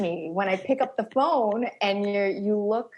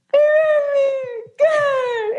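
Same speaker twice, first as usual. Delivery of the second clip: high, teary wail of Good; mock crying; 5 dB louder than usual. Friend mimics Taylor crying over good news, high and teary. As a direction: imitate a sobbing friend. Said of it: "And she's like".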